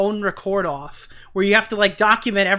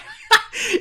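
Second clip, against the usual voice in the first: short high laugh of disbelief; incredulous laugh; 12 semitones higher than usual. Real, not acted. Streamer laughing at the allegation, short high disbelieving laugh.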